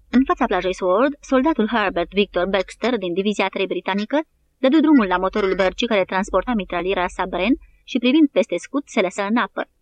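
plain reading voice

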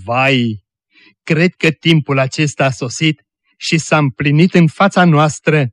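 plain reading voice

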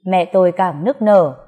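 usual speaking voice

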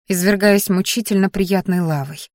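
usual speaking voice

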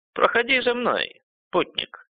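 put-on high, thin old man's call. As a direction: beckon like a frail elder. Старик зовёт путника. (голос дряхлого старика)

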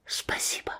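barely voiced, just lips; mouthed whisper. A narrator's reading. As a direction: silent thank-you whisper. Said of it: "одними губами произнёс".

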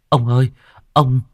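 panicked servant calling out, rushing the words; blurting news in a rush; hurried call. Acted. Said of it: "kêu lên". (hớt hải kêu lên)

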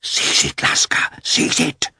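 urgent whisper to the dog; excited whisper. A narrator's reading. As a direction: hiss it, eager and hushed. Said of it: "saying in an excited whisper".

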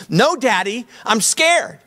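high-pitched imitation of a frightened little girl; mock-scared child's plea, pitched well up; scared daughter. Preacher acting his daughter on the roller coaster, mock-scared. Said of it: "she would say this".

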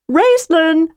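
high, cheerful call from outside. Acted. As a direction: call his name high and eager. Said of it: "cried a cheerful voice".

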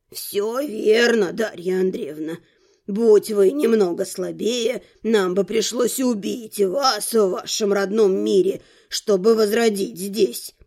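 put-on low, slow old man's voice; grave old rector. A narrator's reading. Rector confirms death was the alternative, grave.

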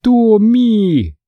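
high, long-drawn call of a name; anguished cry. A narrator's reading. Friend calling Tom vanished in fire, anguished cry. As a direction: wail the name high, stretching it out.

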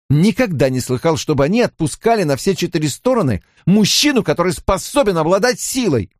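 high, alarmed demands; agitated questioning. A narrator's reading. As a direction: demand answers in disbelief. Mat frantically questions his friend. (взволнованно, высоким голосом)

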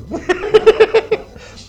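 very high, loud cackle; high laughter. Real, not acted. Friends amused by the blue-label whisky, high cackle. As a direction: cackle at your own aside.

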